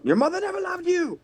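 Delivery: high-pitched mock taunt, called out; calling out. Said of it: "I'm gonna call out".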